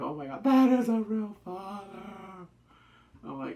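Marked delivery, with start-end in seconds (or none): 0.0-2.5 moved gushing. Retold or imitated.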